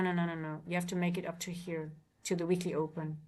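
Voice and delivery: usual speaking voice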